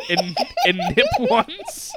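squeaky laughing read. Real, not acted. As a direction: crack up mid-word. Reading anagrams, breaking up.

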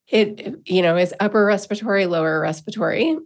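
ordinary delivery

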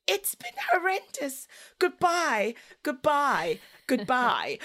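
high sing-song goodbye, fading; breezy exasperated goodbye. Retold or imitated. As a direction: toss out the goodbyes cheerfully desperate. Mum answering teacher dramatically.

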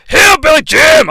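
high, loud ring intro; ring announcer shout. Acted.

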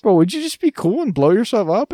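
high, loud pushy bro voice; frat bro. Retold or imitated. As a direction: hype him up like a frat guy begging. Droid voiced pleading with another droid.

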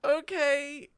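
high, loud burst at the roll; excited dice shout. Real, not acted. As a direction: yelp as the die lands.

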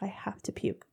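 whisper. Retold or imitated. Whispered retold quote.

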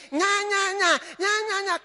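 mock baby crying nya. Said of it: "Stop crying like a baby".

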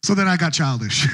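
ordinary delivery

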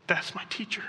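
hushed realisation. Acted. Mary's sudden recognition voiced.

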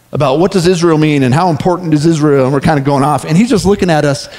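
normal talking voice

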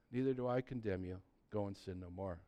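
tender. Acted. Jesus voiced gently.

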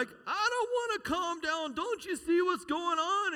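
high, slow, pleading panic; retold frantic outburst. Retelling how he begged his wife, high frantic plea.

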